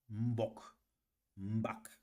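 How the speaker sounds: low-toned "Mbok", then "Mbak". Shown as a demonstration. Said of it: "Ça, c'est le ton bas".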